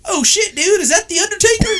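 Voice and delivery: high, fast excited gasp; retold starstruck excitement; excited friend. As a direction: sound like you just spotted a star. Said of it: "and L J goes".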